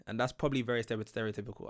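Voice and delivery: ordinary delivery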